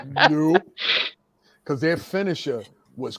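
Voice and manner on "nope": nope said through a laugh. Real, not acted. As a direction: chuckle the refusal. Refusing, laughing.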